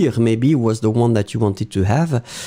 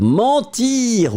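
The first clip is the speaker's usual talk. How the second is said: "Mentir" repeated higher in mock disapproval; mock shocked; 8 semitones higher than usual. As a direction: echo "Mentir" as if scandalised. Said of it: "Ouh, ça n'est pas beau".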